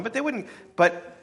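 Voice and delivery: ordinary talk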